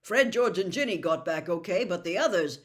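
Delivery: calling out. A narrator's reading. Charlie calling to his father from the tent, loud call. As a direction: report it across the dark.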